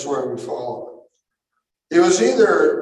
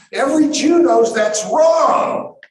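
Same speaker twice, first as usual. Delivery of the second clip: much higher, loud emphatic declaration; preaching shout; 10 semitones higher, 7 dB louder than usual. Real, not acted. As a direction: drive the point home to the congregation.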